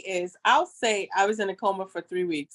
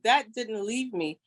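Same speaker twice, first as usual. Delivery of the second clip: ordinary delivery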